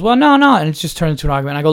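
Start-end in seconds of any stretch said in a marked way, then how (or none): 0.0-0.6 high, loud protest; wife protesting. Retold or imitated. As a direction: jump way up and object loudly. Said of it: "And she goes".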